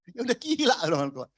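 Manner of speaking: usual speaking voice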